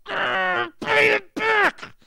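a full-throated, slow angry cry; intense fervor. Shown as a demonstration. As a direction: roar the demand as if choking him. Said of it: "There was some intensity, some emotion, some fervor".

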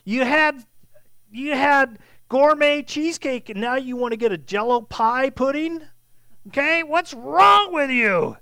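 exasperated scolding, loud and high; reprimanding. Acted. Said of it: "See, he's reprimanding them".

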